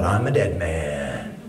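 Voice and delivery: low, slow doomed mutter; low dread. Retold or imitated. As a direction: sink into dread. Inner dread.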